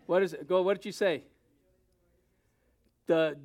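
ordinary delivery

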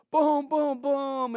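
imitating thunder claps; thunder booms. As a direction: boom it out like thunder.

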